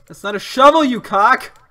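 loud, high jokey outburst; teasing yell. Real, not acted. Gamer yelling a jokey insult at his friend.

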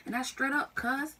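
acted fury in a thick Southern accent, loud and high; mad Southern rant. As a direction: go off on them, accent coming out strong. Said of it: "Like, when I get mad".

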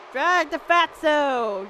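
slow, stretched-out call; long, lingering delivery; drawn-out command. Real, not acted. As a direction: drag the words out as you order it. Chanting the move at the game.

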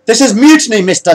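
high, loud outraged shout. A narrator's reading. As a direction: shout down a mutineer. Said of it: "shouted the captain".

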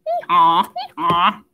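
low braying hee-haw; donkey hee-haw. Shown as a demonstration. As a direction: bray like a donkey. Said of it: "Can you make a donkey sound".

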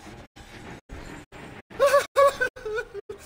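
shrill relieved laughter; nervous high laugh. Real, not acted. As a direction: laugh it off, still shaking. After the scare.

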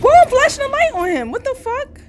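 high, loud, rushed protest; real shriek of disbelief; alarmed outburst. Real, not acted. Player caught out in the game, alarmed outburst.

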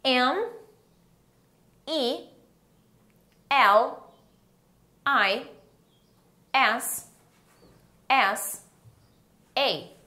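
one letter at a time, long pauses; spelling aloud. Shown as a demonstration. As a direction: leave a gap after each letter for learners to join in. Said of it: "Vamos soletrar junto comigo?". (soletrando letra por letra, com pausas)

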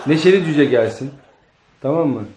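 usual speaking voice

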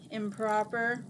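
ordinary delivery, nothing marked

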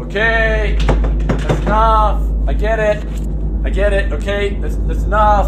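rattled, insistent shouting; fed-up calling out. Acted. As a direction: call out that the prank must stop. Yells at supposed pranksters to stop.

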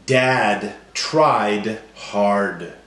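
slow and deliberate, each word drawn out; slow, stretched sentence. Shown as a demonstration. Teacher modelling end sounds slowly to class. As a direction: stretch every final d. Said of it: "every word's gonna end with the same sound".

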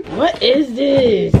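slow, teary laugh while asking; overwhelmed, laughing and crying at once. Real, not acted. Opening a gift from her best friend, laughing through tears.

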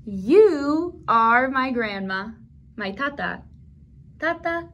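ordinary talk, nothing marked

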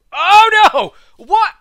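high, loud cry of surprise; shocked yelp. Real, not acted. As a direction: yelp in alarm as you fall. Sudden fall in game.

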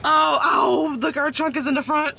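high excited outburst about the front trunk. Real, not acted. Wife shows viewers the car's front trunk, excited.

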